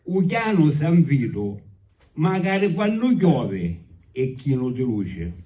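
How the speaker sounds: slow declaimed poem in Sicilian; sicilian accent. Acted. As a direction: recite the verses slowly in Sicilian. Recites his Sicilian poem.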